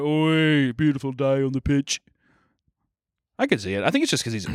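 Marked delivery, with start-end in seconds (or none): 0.0-2.0 a hammed-up Birmingham accent; jokey Birmingham accent, lightly done; mock Birmingham accent. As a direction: Brummie footie-fan voice. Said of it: "Birmingham accent".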